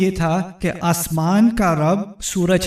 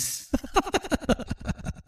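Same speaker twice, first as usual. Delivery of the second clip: breathy laugh; 8 semitones higher, 11 dB quieter than usual. Real, not acted. After teenager joke.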